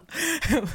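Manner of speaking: high bright laugh; quick burst of laughter. Real, not acted. Laugh heard.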